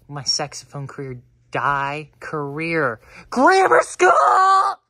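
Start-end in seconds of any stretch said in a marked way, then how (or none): 3.3-4.8 very high, drawn-out yell; sudden shout. Pretend. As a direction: burst out in a long, high, loud cry. Sudden outburst amid career worries.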